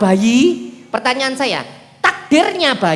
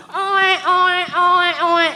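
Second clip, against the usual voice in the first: put-on baby cry; 6 semitones higher, much slower than usual. Baby crying again.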